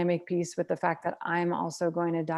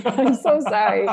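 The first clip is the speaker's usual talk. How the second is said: laughing apology; 6 semitones higher, 10 dB louder than usual. Real, not acted.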